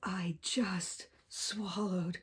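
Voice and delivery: hushed, slow, frightened whisper; scared whisper. Acted. Crocodile whispering to the child in sudden fear. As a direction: breathe it out in dawning dread. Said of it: "crocodile realizes".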